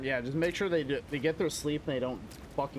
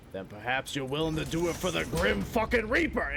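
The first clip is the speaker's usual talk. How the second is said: play-acted tough talk, swelling at the end; mock threat. Acts out threatening a small child.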